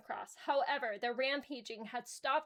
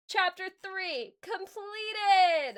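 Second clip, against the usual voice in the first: slow, loud, higher triumphant shout; excited cheer; 7 semitones higher, 8 dB louder, much slower than usual. Real, not acted. Reader celebrating finishing the chapter with a loud cheer.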